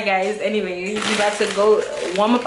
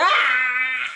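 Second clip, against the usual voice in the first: frightened yelp; 2 semitones higher than usual. Real, not acted. Panicking before eating spicy ramen.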